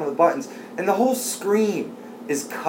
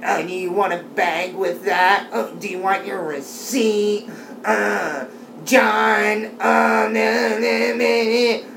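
lightly voiced bored clerk; a hint of a dull store clerk; tired cashier; 7 semitones higher, slower than usual. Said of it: "I do anybody's voice, it's gonna be like this".

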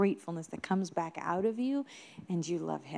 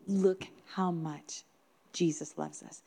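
reflective; 3 dB quieter, slower than usual. Real, not acted. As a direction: let it sink in slowly, softly at the end. Preacher's reflective repeat of child's words.

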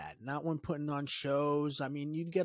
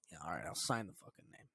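resigned mutter; 8 semitones lower, 11 dB quieter, faster than usual. Retold or imitated. Inner voice signing up reluctantly.

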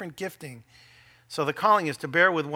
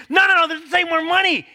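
higher, louder hammed-up protest; insistent mock spouse; 11 semitones higher, 8 dB louder than usual. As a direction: hammer the point home. Mock argument.

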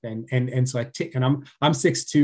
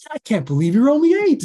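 high and loud, marvelling; a father stunned by his eight-year-old's wisdom, delighted; amazed delight; 12 semitones higher, 6 dB louder than usual. Retold or imitated. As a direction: burst out in proud amazement.

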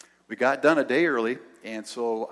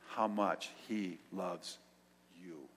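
tender hush; 5 semitones lower, 11 dB quieter, much slower than usual. Real, not acted. Tender telling of God's love.

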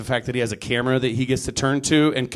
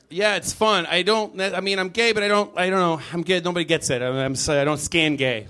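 ordinary talk, nothing marked

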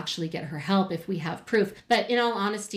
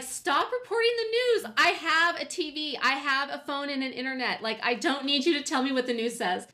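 exasperated retort; 6 semitones higher than usual. Retold or imitated. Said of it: "and I'm like".

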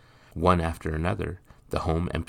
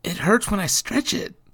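put-on young boy voice; 6 dB louder than usual.

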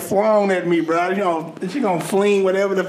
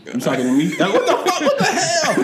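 mock incredulity; 8 semitones higher than usual. Acting out oblivious partner caught unaware.

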